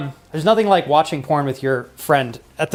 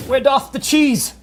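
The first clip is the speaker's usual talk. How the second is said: mock archaic; 10 semitones higher, slower than usual. Mock archaic question to wife.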